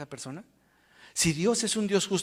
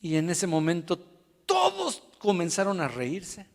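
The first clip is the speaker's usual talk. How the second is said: ordinary talk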